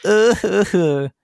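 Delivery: squeaky falsetto fake sobs; hammed-up crybaby noises; mock crying. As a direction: whimper in a tiny, very high voice. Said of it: "I cry my little winky eyes out".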